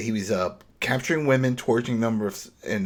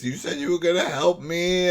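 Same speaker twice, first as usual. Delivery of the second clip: put-on higher, changed voice for the dead girl; ghostly accusation; 8 semitones higher, 3 dB louder than usual. Retelling Cindy's vision confronting him, higher ghostly voice. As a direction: play her haunting him, pleading. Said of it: "she's like in his face".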